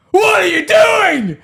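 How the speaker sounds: high, loud, drawn-out panicked yell; alarmed supervisor shrieking; freaking-out shout. Retold or imitated. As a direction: scream it up at someone on a ladder. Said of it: "he's, like, full freaking out".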